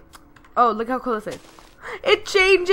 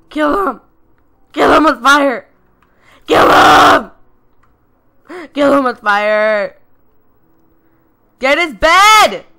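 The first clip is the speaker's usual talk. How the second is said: loud battle cries, pushing hard; rally the team, yelling; shouted orders; 5 semitones higher, 11 dB louder than usual. Real, not acted. Gamer yells at his teammate to attack, loud battle shouts. Urging teammate during fight.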